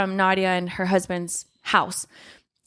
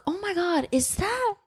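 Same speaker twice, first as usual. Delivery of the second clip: high, gasping mock shock; mock surprise; 9 semitones higher than usual. Friend retelling Sasha waking up, high mock shock. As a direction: play up her fake surprise. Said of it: "And she's just like".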